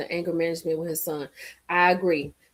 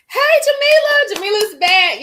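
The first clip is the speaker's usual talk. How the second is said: excited greeting; 11 semitones higher, 8 dB louder than usual. Real, not acted. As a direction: greet her with delight. Greeting a returning viewer.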